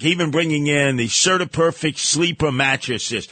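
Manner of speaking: ordinary talk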